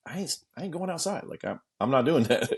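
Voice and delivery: quietly chickening out; timid refusal. Retold or imitated. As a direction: meekly refuse. Refusing the fight.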